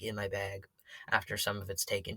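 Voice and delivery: usual speaking voice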